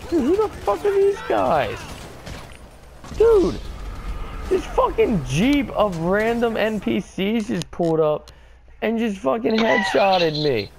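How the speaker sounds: high-pitched indignant yelling; shocked outrage. Real, not acted. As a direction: squeal in disbelief at being shot. Shot by NPCs in jeep.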